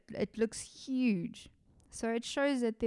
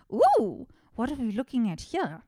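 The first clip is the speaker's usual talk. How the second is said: excited curiosity; 2 semitones higher, 6 dB louder than usual. Retold or imitated. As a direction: act out an excited discovery. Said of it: "my excitement kinda got the better of me".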